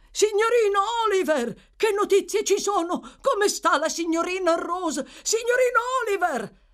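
very high, loud, urgent calling; stentorian shouting. Acted. Servant calling to Oliver for news, shouting. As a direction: yell questions out of a moving coach. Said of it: "una voce stentorea sbraitò".

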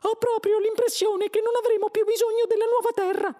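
very high, loud squeak; Frankie. A narrator's reading. Mouse announces the new Earth is unneeded, squeaky voice. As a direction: pipe it out as a mouse. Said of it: "disse il topo Frankie".

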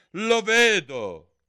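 high, loud, drawn-out retort; angry interruption. A narrator's reading. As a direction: cut him off as the cross queen. Angry queen cuts gardener off. (interruzione adirata)

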